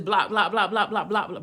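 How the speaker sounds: quick run of repeated "block"; rapid repetition. Retold or imitated. Storyteller mimicking her blocking each number, rapid-fire repetition. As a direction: rattle off "block" over and over.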